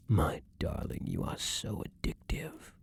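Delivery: unvoiced, dazed whisper; intoxicated whisper. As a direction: breathe it out, lost in the taste. Vampire intoxicated by lover's blood.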